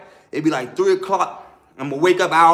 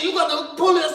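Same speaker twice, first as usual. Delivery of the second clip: retold yelling; 10 semitones higher than usual. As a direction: fire off a high, accusing question. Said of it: "So I'm yelling now".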